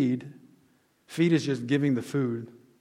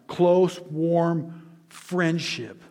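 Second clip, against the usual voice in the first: slow, savoring; 2 semitones higher, much slower than usual. Real, not acted. Defining phileo love.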